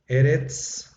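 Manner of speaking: eretz, both syllables clear, rising at the end; teacher models eretz; Hebrew word eretz. Shown as a demonstration. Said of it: "la palabra".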